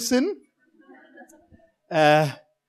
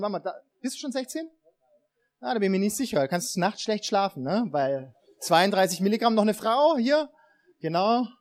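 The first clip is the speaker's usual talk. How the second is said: ordinary delivery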